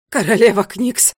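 loud, pained cry for help; groaning call. A narrator's reading. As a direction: groan it out loudly. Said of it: "простонал он". (громкий стон-призыв)

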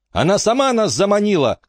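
high-pitched cry at contained volume; shouted protest. A narrator's reading. Bronwyn shouting back at the accusers, high protest. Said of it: "крикнула Бронвин".

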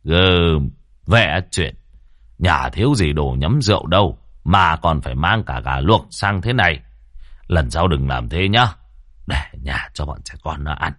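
put-on low, gentle chiding of an old host; genial old man. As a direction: grumble fondly at the late young men. Lân scolds the gift fondly.

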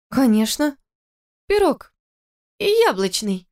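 put-on high, eager tipsy girl; drunk girl voice. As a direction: nod along happily, drunk. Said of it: "закивала Кира".

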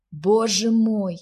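loud, drawn-out groan of dismay. A narrator's reading. Jasper learning of the invented children, groaning aloud. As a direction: groan it slowly, appalled. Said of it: "простонал Джаспер".

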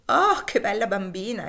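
loud bright cry of delight; delighted exclamation. Acted. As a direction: gush over the disguised child. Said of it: "esclamò Elisa". (la madre esclama entusiasta davanti al figlio travestito)

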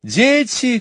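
high, loud upset call; mother's high, anxious calling. A narrator's reading. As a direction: call the children from the doorway. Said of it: "кричала миссис Банкс".

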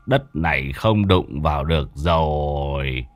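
grim dread. Acted. Shaman seeing the ghosts, muttering in grim dread. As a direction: murmur the bad news under your breath.

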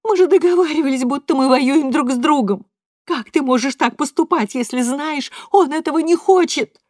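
mother yelling through tears; mother confronting father, tearful shout. A narrator's reading. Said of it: "захлёбывается слезами и словами".

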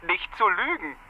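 mocking disbelief. Lieutenant mocks the princess.

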